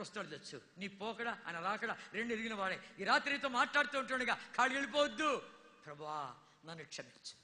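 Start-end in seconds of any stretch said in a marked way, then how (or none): 5.9-7.3 lower, soft humble plea; penitent prayer. Acted. Preacher voicing a sinner's prayer, soft and humble. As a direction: murmur it like a confession.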